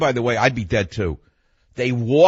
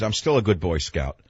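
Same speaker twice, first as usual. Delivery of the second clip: ordinary delivery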